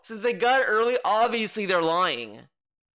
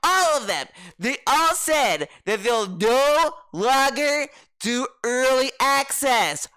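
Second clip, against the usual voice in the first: hyped DSB podcast rant; 5 semitones higher than usual. Retold or imitated. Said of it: "this will go on his little fucking dumbass podcast".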